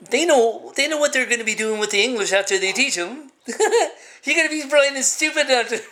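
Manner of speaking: high voice breaking into real laughter; laughing scorn. Real, not acted. Scoffing at those teaching English, laughing scornfully.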